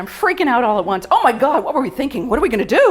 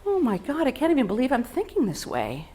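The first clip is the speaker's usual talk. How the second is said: ordinary delivery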